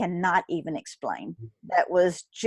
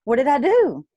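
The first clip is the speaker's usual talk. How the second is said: retold alarm; 6 semitones higher, 5 dB louder than usual. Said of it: "It scared the shit out of me".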